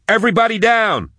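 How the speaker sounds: very high warning yell; urgent, high-pitched command. A narrator's reading. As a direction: yell for everyone to take cover. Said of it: "she yelled".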